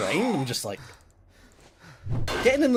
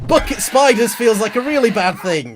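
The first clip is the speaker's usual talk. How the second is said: alarmed loud; 9 semitones higher, 11 dB louder than usual. Real, not acted. Alarmed at new enemy.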